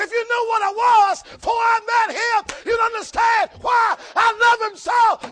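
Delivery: shouted preaching climax; preaching at full force. Acted. Preacher shouting to the church, full force. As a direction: whoop it out to the congregation.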